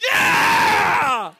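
loud, high cheer like a touchdown; football cheer. Retold or imitated. New dad cheering the birth like a football fan. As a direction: shout it like your team just scored. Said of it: "it was like watching football".

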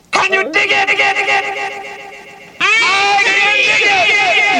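very high sustained echoing chant; response number four. Shown as a demonstration. Performer trying response number four, high drawn-out wail. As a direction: wail it and repeat it, stretching the end. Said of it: "I'll try, try response number four".

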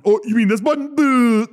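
high, loud playful quote; playful mock actor. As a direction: tease it like a blooper. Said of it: "funniest outtakes".

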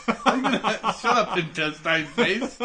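teasing, giggling insult; half-laughing put-down; laughing mock insult. Real, not acted. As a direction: tease him through laughter. Playful mock insult while laughing.

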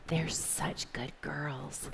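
slow, hushed murmur of approval; admiring whisper. Retold or imitated. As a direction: whisper it like onlookers approving of well-behaved children. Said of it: "those people would be like".

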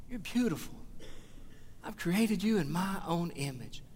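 soft, tender reassurance; tender voice of God. Retold or imitated. God tells his listeners they are loved, softly and tenderly. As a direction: speak it softly, like a loving father. God's loving words.

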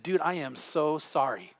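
low, soft, slow fake condolence; mock sympathy. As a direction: pretend to grieve for him. Mock pity after reading verse.

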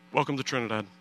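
soft, low-key retold welcome; understated official welcome. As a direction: say it quietly in one quick phrase. Officer unexpectedly admits him, first take.

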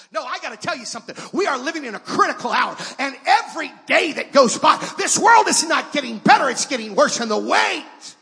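rising from firm to shouted preaching; building to full-force preaching. Acted. Preacher to congregation, climbing into a shout. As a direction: build it up till you are shouting the gospel.

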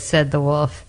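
ordinary delivery

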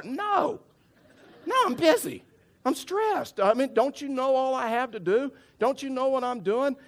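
very high, indignant mock rant; hammed-up frazzled outburst; stressed refusal. Husband refusing wife's errand on the phone, comically frazzled.

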